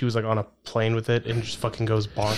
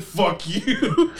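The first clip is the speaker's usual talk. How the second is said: higher mock-angry cry; hammed-up animal outrage, pitched up; attacking gorilla; 7 semitones higher than usual. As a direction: voice a furious gorilla through laughter.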